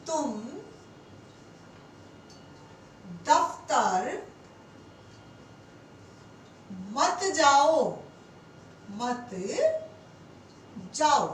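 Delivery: teacher spaces out "तुम दफ्तर मत जाओ" with long pauses, "मत" set apart; slow dictation. Shown as a demonstration. Teacher dictating an example of forbidding to students, slow. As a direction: dictate word by word, slowly. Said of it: "इसी के साथ क्या कहें कि आज".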